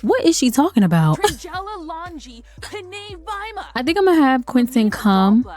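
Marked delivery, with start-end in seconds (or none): 1.2-2.2 high, quiet giggle; short giggle under the breath; soft giggle. Real, not acted. Watching her Sims chat away, soft high giggle. Amused at the chatting.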